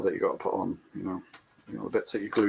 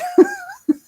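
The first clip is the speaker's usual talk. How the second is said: laugh; 11 dB louder than usual. Real, not acted. Laughs at own remark.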